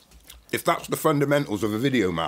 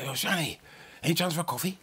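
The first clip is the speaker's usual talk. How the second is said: timid request; 9 semitones higher, 6 dB quieter than usual. Retold or imitated. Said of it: "So I say".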